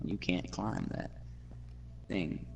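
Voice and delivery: usual speaking voice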